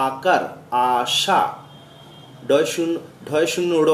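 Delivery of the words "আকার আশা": very slow, stretched আকার আশা; slow spelling. Shown as a demonstration. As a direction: stretch each syllable slowly. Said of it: "উচ্চারণ করলে". (ধীরে টেনে বলা)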